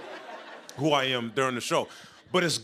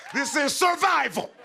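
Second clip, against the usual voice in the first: high, drawn-out comic cry; desperate outburst; 10 semitones higher, 4 dB louder, slower than usual. Acted. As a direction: plead it as life or death. Mock-desperate punchline about whoopings.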